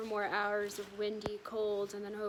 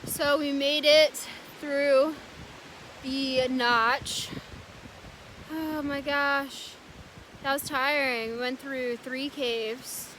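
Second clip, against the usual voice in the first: excited relief; 3 semitones higher, 9 dB louder, slower than usual. Real, not acted. Relief after the Notch.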